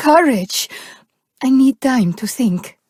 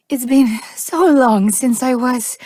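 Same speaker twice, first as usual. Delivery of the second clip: ordinary talk, nothing marked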